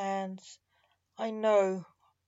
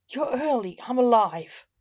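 loud, a bit higher, jubilant; triumphant cry; 4 semitones higher, 9 dB louder than usual. Acted. Vivian, reprieved from death, cries out in triumph. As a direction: shout it like a reprieve. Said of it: "Vivian cried triumphantly".

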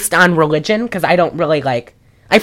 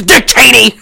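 loud, higher sudden outburst; crazed shout; 5 semitones higher, 5 dB louder than usual. Retold or imitated. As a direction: blurt it out like a raving conspiracy theorist. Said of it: "the conspiracy theorist shouts Dick Cheney".

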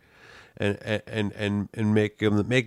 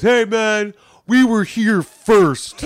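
high, slow mocking whine of the heckler; whiny heckler; 10 semitones higher, 8 dB louder, much slower than usual. Comic mimicking a rude bar patron, slow high whine. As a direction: stretch it out like an offended heckler. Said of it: "the guy goes".

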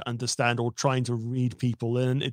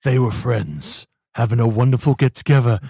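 movie trailer voice; 3 semitones lower, 9 dB louder than usual. Acted. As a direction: announce it like a horror trailer. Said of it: "trailer voice time".

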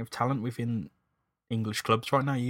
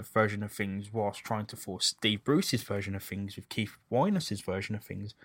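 ordinary talk, nothing marked

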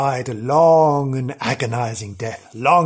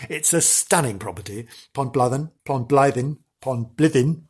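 ordinary talk

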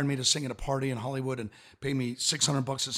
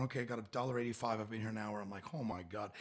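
much softer, sinking under the breath; dismayed mutter; 5 semitones lower, 14 dB quieter than usual. Retold or imitated. Busking [name] counting his change, soft dismayed mutter. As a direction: quietly realise it's hopeless. Earning almost nothing busking.

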